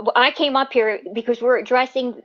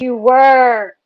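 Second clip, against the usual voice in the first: loud sharp voice; 8 dB louder than usual. Real, not acted. Heated argument, raised voice.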